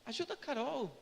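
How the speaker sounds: soft, conversational chat with God; intimate casual prayer. Retold or imitated. Driver praying for Carol's lost cat, intimate casual prayer. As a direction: pray quietly, as if talking to your dad. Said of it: "muitas vezes eu falo".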